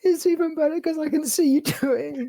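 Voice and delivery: high, loud words through laughter; shrieking with laughter; cry-laughing. Real, not acted.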